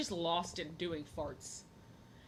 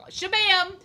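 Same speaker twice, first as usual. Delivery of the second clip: Shabam belted out high and loud; loud punchline shout; 12 semitones higher, 14 dB louder than usual. Pretend. Telling viewers the joke she made, loud high shout. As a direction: slam it like a comic-book sound effect. Punchline sound effect in story.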